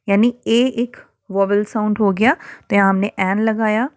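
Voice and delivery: ordinary delivery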